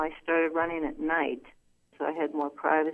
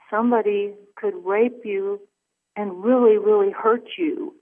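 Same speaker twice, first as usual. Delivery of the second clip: louder, slowing on really hurt you; insistent warning; 2 semitones higher, 7 dB louder than usual. Retold or imitated. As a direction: press the danger home, louder. Attacker insists, repeating warning.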